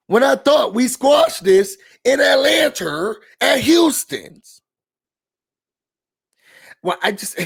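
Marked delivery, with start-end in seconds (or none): 0.1-4.6 higher and loud, incredulous; exasperated shout. Real, not acted. Ranting about the squashed beef, loud and incredulous. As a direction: let it burst out. Venting at the show.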